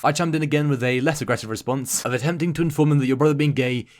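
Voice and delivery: ordinary talk, nothing marked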